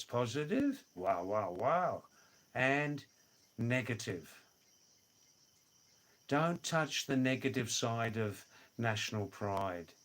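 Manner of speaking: ordinary talk, nothing marked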